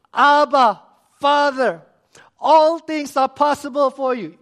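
very loud, high cry to heaven; acted impassioned cry with spaced-out address; anguished prayer. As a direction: call out to the Father at full force. Acts the same anguished prayer again.

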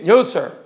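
imitating a slurred "yo, sir"; "yo, sir" run together, second word dropped away. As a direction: blur "yo, sir" so it could be yes or no. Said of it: "he has formed a habit of slurring".

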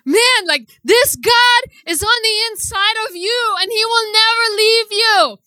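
high, emotional preaching with a breaking voice; tearful preaching. Real, not acted. Pleading with the woman in the museum, tearful preaching. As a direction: preach it through tears. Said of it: "started to preach at this woman".

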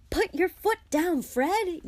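high, urgent shout to the driver; yelled. A narrator's reading. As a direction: yell it as a frantic getaway order. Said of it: "yelled Ron".

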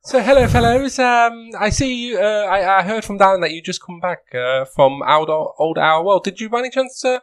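high, light woman's voice. Acted. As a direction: pitch it up for the NPC lady. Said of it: "I'm not even gonna try and do a woman's accent".

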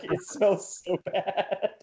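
high, soft and slow whimpered "It smells so bad"; mock whimper. Runner repeating the trash complaint to the pregnant wife, soft slow whimper. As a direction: let it sag into a pitiful drawn-out whine. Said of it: "And I was like".

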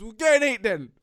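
loud mocking teacher yell; teacher shouting. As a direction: shout the verdict like a bossy teacher. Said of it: "Mister Benson shouts at him".

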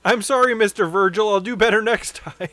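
high, loud grovelling apology; mock apology. Botching wrestler apologises to Virgil, mock grovelling. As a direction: play a wrestler pleading for forgiveness.

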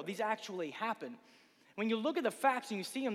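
normal talking voice

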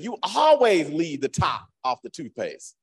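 exaggerated nagging, slow on the big word; mock complaint. Spouse about the toothpaste, exaggerated accusation. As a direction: stretch ALWAYS into a loud accusing wail.

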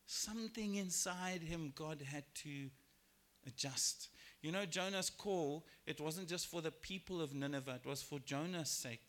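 ordinary delivery

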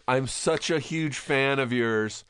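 high, slow fan gush; starstruck fan gush. Retold or imitated. Casting director greeting an actor she admires, high gush. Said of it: "She goes".

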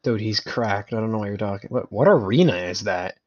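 ordinary delivery

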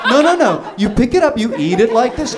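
high, hurried mock correction; corrective instructing. Husband teaching Kathy to eat corn, acted correcting.

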